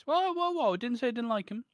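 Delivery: high loud protest; squeaky indignant objection. Real, not acted. Defending his Rice Krispie cakes.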